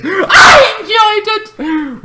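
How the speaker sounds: very high, slow, loud declaration; slow shrieking exclamation of delight; excited shout. Real, not acted. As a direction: belt out how much you loved it. Emphatic claim to viewers.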